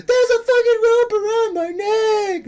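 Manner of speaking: very high, slow hammed-up shriek; mocking the victim's hysterical shriek; mock panicked scream. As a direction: drag out a panicked mocking wail. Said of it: "Like, screaming it out".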